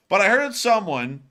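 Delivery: normal talking voice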